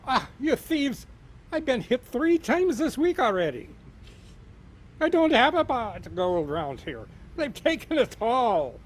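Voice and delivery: high, loud, half-laughing Irish leprechaun; indignant leprechaun. Acted. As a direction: complain like a robbed little leprechaun. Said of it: "the leprechaun looks back at him and says".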